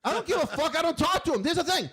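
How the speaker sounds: high-pitched, heated protest; indignant outburst. Real, not acted. Host defending his impression of Jake, indignant and high. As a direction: brush off the criticism, voice shooting up.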